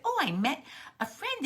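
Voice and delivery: high, eager little-one voice; excited little frog. Acted. Little Frog tells mother of a new friend, excited. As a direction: pipe up high with happy news.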